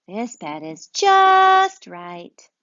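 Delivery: a bear sinking into bed happily; slow happy bear, stretched out; contented bear. Acted. Bear voiced in read-aloud story.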